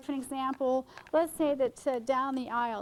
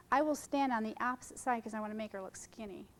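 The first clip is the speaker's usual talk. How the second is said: usual speaking voice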